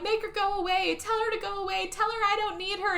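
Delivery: high-pitched acted plea; distraught pleading. Distressed wife begs husband to send someone away, high pleading.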